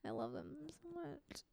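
soft gushing whisper. Real, not acted. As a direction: whisper it adoringly. Gushing over wedding photos.

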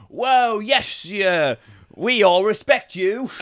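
high, loud, over-eager Brits; mock British crowd. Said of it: "everyone in Britain's like".

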